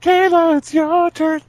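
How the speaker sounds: very high call, falling on the name; sing-song call across the room. Acted. Calling a friend to take her turn.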